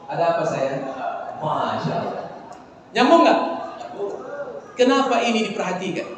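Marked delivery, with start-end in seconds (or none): 0.1-2.2 soft, much lower, tender answer to the wife; tender reply. Shown as a demonstration. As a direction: turn and answer her lovingly. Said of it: "Yang terbaik, balikkan wajahnya". (jawaban lembut penuh sayang)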